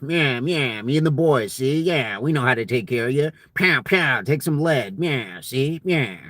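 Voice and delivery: put-on nyah-see old-timey mobster patter; G Edward Robinson. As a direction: play an old-timey movie tough guy. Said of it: "the old-timey accent, the G Edward Robinson".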